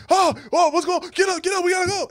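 high, loud, frantic fake alarm; mock alarm shout. Prankster bursting into the bedroom, shouting high and loud in mock alarm. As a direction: yell at the sleeper to get up.

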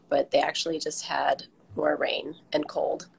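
usual speaking voice

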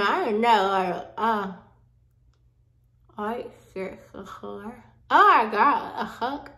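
strip on teeth; fingers in mouth. Real, not acted. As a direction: lips held apart. Seen 0: fingers at mouth pressing strip onto teeth while speaking.

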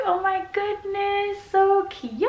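high, gushing "so cute"; gushing delight. Real, not acted. Gushing to viewers over the cute cat, high pitched. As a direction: coo over it with real delight.

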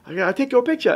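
high-pitched cute accent imitation; amateur accent impression, high and sweet; Brother John's accent. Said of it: "how cute his accent kinda is".

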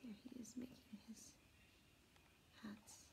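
soft unvoiced aside; hushed aside to listeners; whisper. Real, not acted. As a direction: whisper while pointing at the picture. Aside while showing picture.

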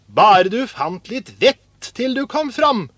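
high, loud, fed-up retort; exasperated jibe. A narrator's reading. Older brothers mock Askeladden's finds, exasperated. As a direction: snipe at a foolish brother.

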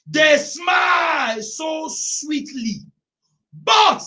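high, loud, very slow shouted scripture; full-force preaching. Acted. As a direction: thunder the verse over the congregation.

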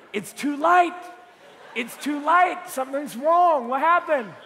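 loud, high comic alarm; mock alarm. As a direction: overplay the dismay in punchy bursts. Acts alarm at too-light burrito.

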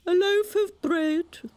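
high, slow storybook walrus oration; grand walrus. A narrator's reading. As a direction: announce it like a pompous walrus. Walrus speaks.

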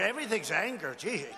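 normal talking voice

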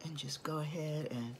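soft unvoiced aside; whisper. Real, not acted. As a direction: whisper it to yourself. Aside while applying makeup.

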